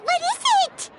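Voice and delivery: eager repeat. Acted. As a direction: bounce on it, impatient to know.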